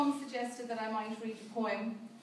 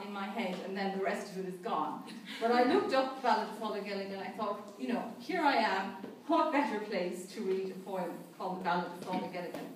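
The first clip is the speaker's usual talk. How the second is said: normal talking voice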